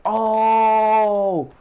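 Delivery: long, high, loud ooh; loud mock crowd holler; imitated crowd uproar. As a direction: hold it like a whole crowd reacting. Acts out the crowd reacting.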